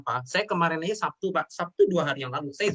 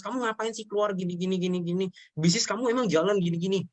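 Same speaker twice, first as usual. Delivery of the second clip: nagging mother; 5 semitones higher than usual. Retold or imitated. Mother nagging her son.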